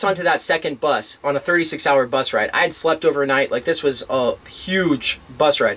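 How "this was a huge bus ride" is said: ordinary delivery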